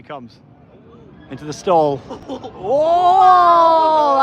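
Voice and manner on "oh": drawn-out high cry of amazement; real astonished whoop; awed whoop. Real, not acted. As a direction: let out a long, thrilled "oh". Watching coaster go through the stall.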